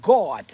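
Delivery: "God" ending in a popped d; "God" with a released "-duh"; emphatic final D. Shown as a demonstration. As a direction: hit the last consonant of "God" hard. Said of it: "Not God".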